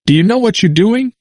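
put-on a high, forceful old man's challenge; stern elder demanding an answer; forceful question. A narrator's reading. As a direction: press him hard with the question. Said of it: "and said forcefully".

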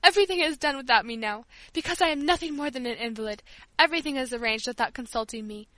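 ordinary delivery, nothing marked

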